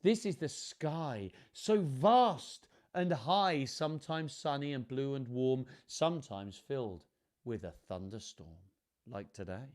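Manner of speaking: measured, lilting picture-book reading; children's story narration. A narrator's reading. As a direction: read it to children, savouring the rhymes. Reads picture book aloud.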